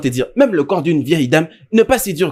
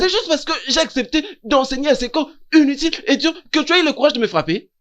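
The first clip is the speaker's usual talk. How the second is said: indignant disbelief; 8 semitones higher than usual. Acted. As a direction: protest aghast at the blow. Teacher aghast at being hit. (indignation incrédule, voix aiguë)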